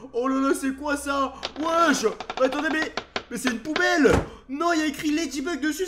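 loud startled exclamation; surprised. Real, not acted. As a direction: jump at the discovery. Finds Ladybug's bin.

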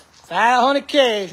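drawn out slowly like a yard-sale barker; slow sales call. Real, not acted. Calling out to buyers for the Harley, slow and drawn out. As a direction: stretch each word to reel in a buyer.

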